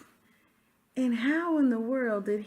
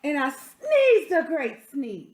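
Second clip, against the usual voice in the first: lively read-aloud as the wolf, a touch louder; story narration; 5 semitones higher, 7 dB louder than usual. Acted. Reading a picture book to children, storyteller voice. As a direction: tell it like the wolf defending himself.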